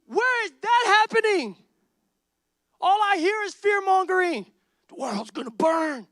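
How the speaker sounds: high, loud, rising fervor; full-force preaching. Real, not acted. Pastor railing against fear-mongering, high and loud.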